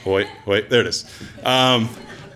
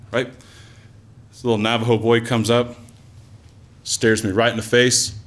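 normal talking voice

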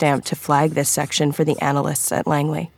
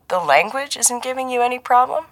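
high-pitched innocent teasing question; baiting a friend, all innocence; a shade too innocent; 8 semitones higher than usual. A narrator's reading. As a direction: ask with feigned innocence, pitched up. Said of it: "voice was a shade too innocent".

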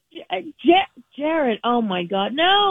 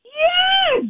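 exasperated shout; 13 semitones higher, 3 dB louder than usual. Real, not acted. Exasperated confirmation.

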